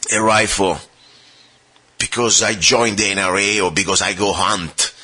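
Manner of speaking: ordinary talk, nothing marked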